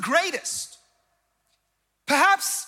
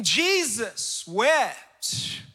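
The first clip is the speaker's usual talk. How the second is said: very slow, weighty; 5 semitones lower, much slower than usual. Acted. Preacher landing the key verse for the church, very slow. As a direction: let each word hang, very slow.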